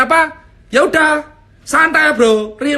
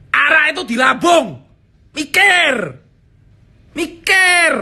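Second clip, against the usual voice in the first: angry shout; 3 semitones higher than usual. Real, not acted. Ranting comic yells at the panicking crowd, angry shout. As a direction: bark it at the fools.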